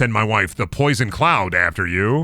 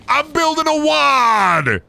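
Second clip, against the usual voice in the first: drawn-out high exclamation; 14 semitones higher, much slower than usual. Retold or imitated. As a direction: stretch it out like a proud boast. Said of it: "Both of them say".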